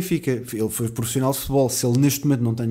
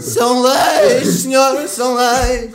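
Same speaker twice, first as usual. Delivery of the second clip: booming high announcer call, much louder; 11 semitones higher, 8 dB louder, much slower than usual. Shown as a demonstration. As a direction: belt it out like a radio jingle. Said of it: "um gajo com oitenta anos".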